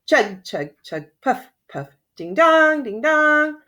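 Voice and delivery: singsong chug chug puff puff, then high ding dong; chugging train sounds. A narrator's reading. Narrator making train sounds for kids. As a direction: play the little train's noises.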